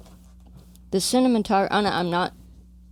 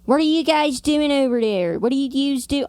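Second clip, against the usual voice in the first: put-on Philly accent; 7 semitones higher than usual. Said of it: "the Philadelphia land area accent".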